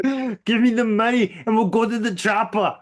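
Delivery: mock German tough-guy robber voice; Schwarzenegger. Shown as a demonstration. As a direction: put on a cartoonish German strongman accent. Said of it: "I did Schwarzenegger".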